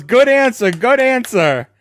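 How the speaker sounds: high, loud team cheer of encouragement; mock cheering. Mimicking cheering game-show family.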